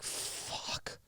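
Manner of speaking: drawn-out whispered curse, acted; whispered dismay. Title clerk seeing the matching picture, stunned whisper. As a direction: whisper it like a sinking private thought.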